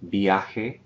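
viaje said as one clean Spanish word, ia together; Spanish word demo. Shown as a demonstration. Teacher models viaje for the class, clear Spanish pronunciation. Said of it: "I'll jump in with my pronunciation".

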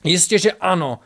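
heated firm affirmation; roared retort. A narrator's reading. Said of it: "zařval na něj Ostrovit".

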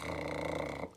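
ルルルルル as a long trilled rolled r. Shown as a demonstration. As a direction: trill the tongue through ルルルルル. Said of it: "ルルルルってやつですね".

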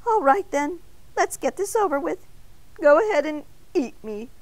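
resigned, though high-voiced; defeated surrender, no real mutter. A narrator's reading. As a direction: give in and get it over with. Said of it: "and muttered".

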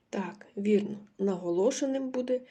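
ordinary delivery

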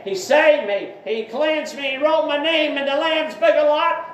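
building to a high, loud cry; rising shout of praise. Real, not acted. Preacher testifying his salvation to listeners, rising loud cry.

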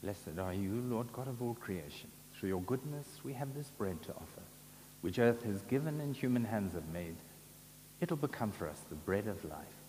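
ordinary delivery

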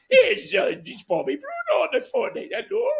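high, hammed-up French accent; French accent on the phone. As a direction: squeak it out like the French manager. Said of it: "just in case you didn't recognise the accent".

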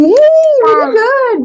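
delighted praise. Real, not acted. Mother praising her toddler for fetching, delighted. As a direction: stretch the praise out high and slow.

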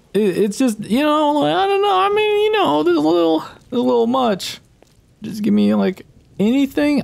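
squeaky scared voice, begging for help; scared horror game streamer. Real, not acted. Nervous about entering creepy place.

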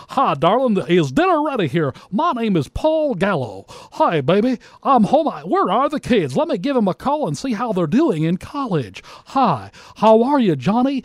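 cheesy DJ voice at the dinner table; radio voice at home. Retold or imitated. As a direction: ham up a cheesy announcer greeting his wife. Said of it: "who in the world talks like that?".

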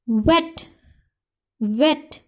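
wet said with a short e sound; short vowel. Shown as a demonstration. Teacher sounding out wet for students, short vowel. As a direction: say "wet" as a lone word with a short e. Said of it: "short sound आ रही है ई की".

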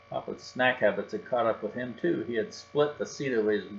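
usual speaking voice

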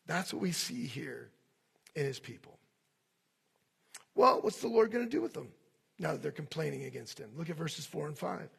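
ordinary delivery

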